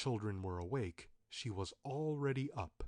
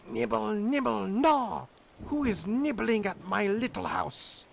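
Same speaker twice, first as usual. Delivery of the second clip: high, loud, strange witch's call; 10 semitones higher, 9 dB louder than usual. A narrator's reading. Witch calling to the children outside, high loud witch voice. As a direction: cry out like a hidden old witch. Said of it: "a soft voice cried".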